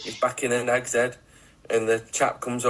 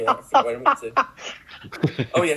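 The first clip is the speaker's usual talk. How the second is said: ordinary talk, nothing marked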